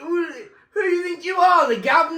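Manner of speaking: very high, loud mock British yell; a hammed-up British tirade; mock British scolding. Brother mock-scolding nephew in British accent. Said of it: "Some British boy?".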